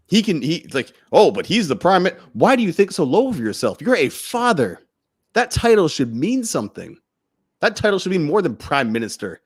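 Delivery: fired up, challenge them to step up; impassioned indignant rant. Real, not acted. Speaker scolding fathers to stand up for their children, heated.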